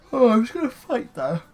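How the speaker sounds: talking through a yawn. Real, not acted. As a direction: let a yawn stretch the words. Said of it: "fight the yawn".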